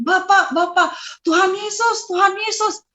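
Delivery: high, urgent cries; calling out. Retold or imitated. Waking from a dream calling Jesus, urgently.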